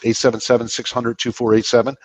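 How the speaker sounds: quick Northern-paced phone number; fast talker. Real, not acted. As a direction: rattle the digits off briskly. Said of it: "you talk faster than us".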